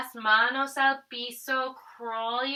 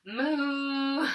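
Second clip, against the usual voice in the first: cow moo. Shown as a demonstration. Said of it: "What's your best cow sound?".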